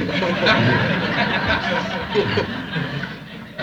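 a long high laugh at his own joke. Real, not acted. Preacher laughing at his coffee cup joke. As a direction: laugh it out with the crowd.

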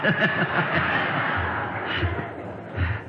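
amused chuckle; breathy laugh. Real, not acted. Preacher laughs at his story.

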